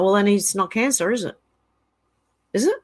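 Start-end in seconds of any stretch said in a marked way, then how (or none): none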